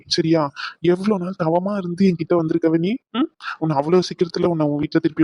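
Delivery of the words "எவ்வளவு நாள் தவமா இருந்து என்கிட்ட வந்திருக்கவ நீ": usual speaking voice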